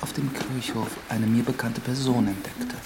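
story narration. A narrator's reading. Priest narrating the coming nightmare as storyteller.

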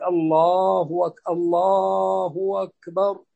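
very slow الله, gliding into "wakbar" for أكبر; hamza turned waw. Shown as a demonstration. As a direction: hold الله long and start أكبر with w. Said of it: "جاز أن تقلب واوا". (نطق "أكبر" بواو بدل الهمزة)

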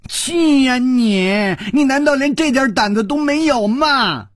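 exasperated high-pitched wail; furious goading. A narrator's reading. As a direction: scream at him to get moving. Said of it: "用拳头擂着他的胸膛说".

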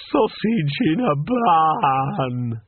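slow, drawn-out wail through tears; bawling. A narrator's reading. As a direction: sob the words out slowly. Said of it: "it bawled".

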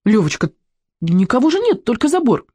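high, pleading protest; exclaim, pitched well up; eager boyish reassurance, high voice. A narrator's reading. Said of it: "воскликнул".

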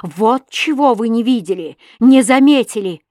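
loud, emphatic accusation; angry denunciation. A narrator's reading. Husband exposes the girl to his wife, angry denunciation. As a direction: hammer each reproach home.

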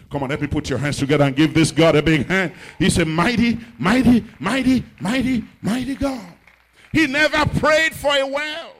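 loud pulpit exhortation; rally the congregation into praise; rousing preaching. Real, not acted. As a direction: preach it loud, building in pitch.

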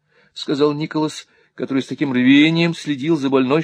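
plain reading voice

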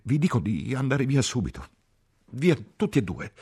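very high, insistent outburst; hysterical order. A narrator's reading. Ferguson repeats her order to the couple, hysterical. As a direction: drive them both out, overwrought.

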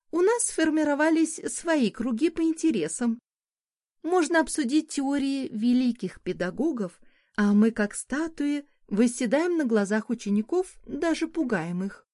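high, lilting society lady; sultry woman. A narrator's reading. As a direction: coax him like a charming hostess. Narrator voices Dessa.

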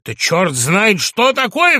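patient leaping up to cry out; outburst. Acted. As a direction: explode with indignation. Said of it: "вскрикнул вдруг Иван Дмитриевич".

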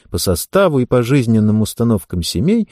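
ordinary delivery

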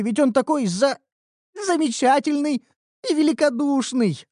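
high, pleading, grudging compliments; forced flattery. Acted. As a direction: squeeze out the praise against your will. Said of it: "С трудом выдавила из себя похвалу".

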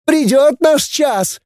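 very high, slow slogan; shrieky, drawn-out girl's proclamation; hysterical ranting. A narrator's reading. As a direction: wail it like a fanatic. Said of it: "кликушествовала она".

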